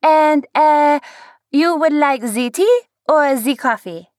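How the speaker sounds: put-on high, loud French accent with z for th; fake French accent. A narrator's reading. Waitress taking an order in fake French. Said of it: "Convince him you're French".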